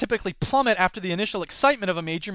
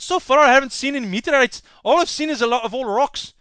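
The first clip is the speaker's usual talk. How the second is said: put-on horrible Scottish accent; 4 semitones higher than usual. Scottish dealer scoffing at online rocks, in a bad Scottish accent. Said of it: "horrible Scottish accents".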